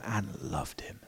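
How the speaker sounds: hushed, reverent whisper; tender whisper. Shown as a demonstration. Preacher to congregation, soft tender whisper. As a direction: breathe it like a secret of love. Repeats line, then whispers it.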